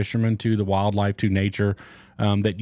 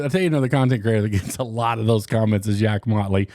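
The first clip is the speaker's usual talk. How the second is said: ordinary talk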